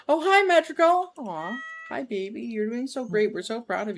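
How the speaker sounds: high cooing pet voice; talking to a pet. Real, not acted. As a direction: coo at the cat. Greeting her pet cat.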